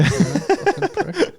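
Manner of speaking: drawn-out hammed-up insult; mock angry. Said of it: "That's a lot of anger there".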